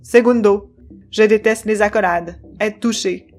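loud, clipped machine voice, stern; choppy robot voice. Acted. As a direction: stiff robot speaking in jerks. Controllo's presentation.

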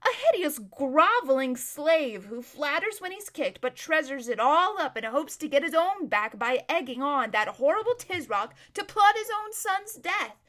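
loud, swooping, disgusted denunciation; contemptuous. Acted. Erebus denouncing the suitor to her friend, loud and contemptuous. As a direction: spit out the insults with relish.

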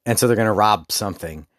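normal talking voice

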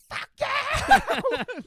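all-out high furious yell; screaming. Retold or imitated. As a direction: scream it at the driver, full force. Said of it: "just, like, screaming".

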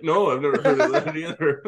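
laughing denial. Retold or imitated. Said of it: "he's like".

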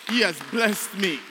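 overflowing thanks; emotional outburst. Real, not acted. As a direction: cry it out in gratitude. Testimony swelling with emotion.

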